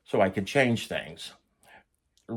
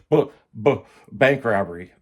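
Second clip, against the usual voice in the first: stuttering on the first sound; imitated stutter; 5 dB louder than usual. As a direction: stumble twice on the B, then get it out. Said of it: "I just start stuttering".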